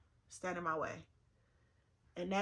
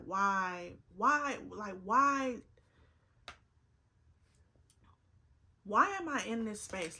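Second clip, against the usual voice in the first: rising exasperated why; 3 semitones higher, 6 dB louder than usual. Real, not acted. Asking God why, getting louder. Venting years of hurt questions.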